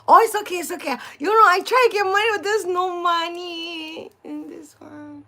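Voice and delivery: high, loud retold reassurance, slowing at the end; imitated nice owner. Owner explaining the empty bag to customer, imitated kindly reassurance. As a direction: rush the explanation, then stretch the ending. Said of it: "He's so nice".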